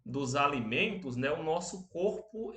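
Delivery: usual speaking voice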